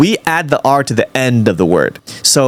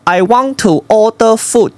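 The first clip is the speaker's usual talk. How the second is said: higher-pitched demo of Chinese-accented English; 7 semitones higher than usual. Shown as a demonstration. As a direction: model a put-on Chinese accent for copying. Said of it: "You do your Chinese accent".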